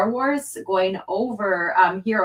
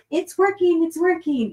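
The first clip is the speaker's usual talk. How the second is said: high-pitched gleeful cheer; excited; 9 semitones higher than usual. Real, not acted. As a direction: celebrate like it finally works. Connection suddenly works again.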